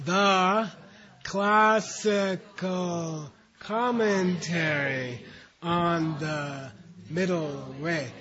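drawn-out, each syllable stretched very slow; very slow unison reading pace; slow group reading. Shown as a demonstration. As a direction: lead the class through it slowly, syllable by syllable. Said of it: "Read these four words on the count of three".

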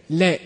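short, clean 'Le'; short length. Shown as a demonstration. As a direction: keep the vowel of 'Le' short. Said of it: "three different lengths of it".

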